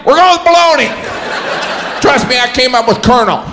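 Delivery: high-pitched mock certainty; stubborn word-coiner. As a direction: overrule them in a high, forceful voice. Stubborn decider overrules the objection.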